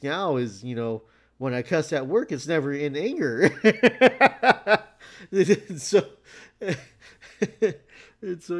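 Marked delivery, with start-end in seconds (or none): 2.3-8.6 laughing through the words. Real, not acted. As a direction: break into laughter mid-sentence. Laughs at own joke.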